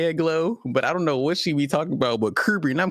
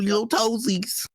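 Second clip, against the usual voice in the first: high, slow cutesy voice; cutesy baby talk; 8 semitones higher, slower than usual. Pretend. Cutesy word for toes.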